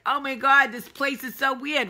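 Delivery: high, loud, conspiratorial exclamation; mocking imitation. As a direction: imitate Northerners complaining about the place. Mimics Northerners mocking the South.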